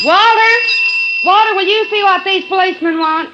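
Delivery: landlady hollering for her husband, loud and high; calling out to Walter. Acted.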